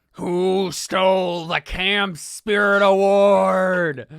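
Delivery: slow, loud, high-pitched booming call; bellowed accusation. Acted. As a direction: roar it across the whole camp, dragging each word. Angry counselor heard from afar.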